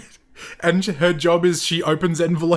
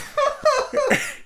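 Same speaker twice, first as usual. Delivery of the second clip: strangled parrot-like squawk; pterodactyl screech. Acted. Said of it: "Sounds like [name]'s strangling a parrot".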